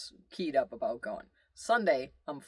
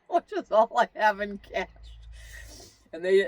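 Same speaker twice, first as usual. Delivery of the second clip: laughing; 6 semitones higher, 7 dB louder than usual. Real, not acted. Laugh heard on line.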